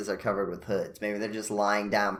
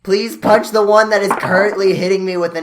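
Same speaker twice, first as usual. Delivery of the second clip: urgent plea; 9 semitones higher, 11 dB louder than usual. Acted. Pleading to ally mid-fight.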